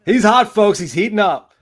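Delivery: loud, high hype-man call; excited hype. Real, not acted. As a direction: hype him up to the crowd. Hyping colleague's eighteen-and-one week.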